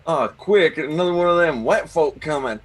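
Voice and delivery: high, loud mock-Southern accent. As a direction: raise an alarm in a put-on Southern accent. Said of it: "talk like this".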